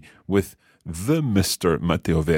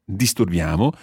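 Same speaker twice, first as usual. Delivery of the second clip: "Disturbiamo" rising at the end; question intonation. Shown as a demonstration. Teacher turning the verb into a question, rising intonation. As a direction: turn "Disturbiamo" into a question by lifting the last syllable. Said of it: "making it into a question just by changing the intonation".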